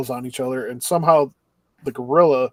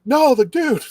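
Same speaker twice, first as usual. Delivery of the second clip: much higher, louder indignant yell; mock outraged shout; 11 semitones higher, 6 dB louder, slower than usual. Classmates voting for the dude, a mock outraged yell. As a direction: shout it down like a crowd. Classmates reject gorilla vote.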